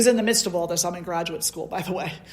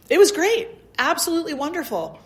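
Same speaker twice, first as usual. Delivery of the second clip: delighted; 8 semitones higher, 4 dB louder, faster than usual. Real, not acted. Delighted about the training.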